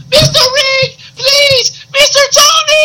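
very high, drawn-out comic wail; Miguel. Pretend. Intruder calling to the hosts while dragged off, squealed wail. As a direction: cry out pleadingly in a squeaky put-on voice.

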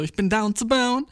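slow, high-pitched imitation of a date; imitated eager confirmation. Date repeating his slang back to him, eager. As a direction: lift it high and stretch it out. Her tentative answer in borrowed slang.

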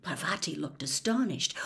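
ordinary delivery, nothing marked

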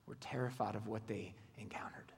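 soft unvoiced whisper; awed whisper. Preacher describing fear of God, whispered. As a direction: drop into a hush.

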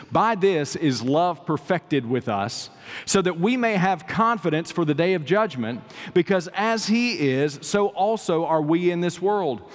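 high-pitched, emphatic sermon delivery; impassioned preaching. Real, not acted. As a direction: proclaim it phrase by weighty phrase to a congregation. Preaches scripture and courtroom parable.

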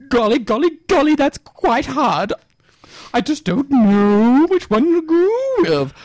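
high comic caller voice, stretched out; flustered quiz caller. Acted. Caller struggling with a hard quiz question, high and flustered. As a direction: dither in a drawn-out high voice.

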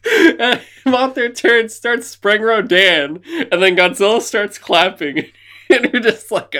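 can't get it out for laughing; laughing while telling. Real, not acted. Telling friend about the monster brawl, laughing through it. Cracking up retelling monster fight.

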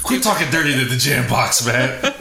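talking through laughter; laughing while scolding. Real, not acted. Laughing at own joke.

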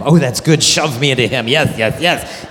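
hammed-up delighted yes yes yes; a giddy teen loving the plan; mock thrilled girl.

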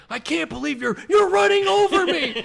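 high, building mock outcry; retold protest. Sibling documenting being run over by a three-wheeler, mock high yelling protest. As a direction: climb into a loud, disbelieving yell. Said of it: "I hear you saying".

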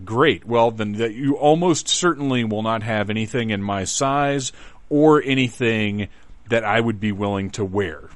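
normal talking voice

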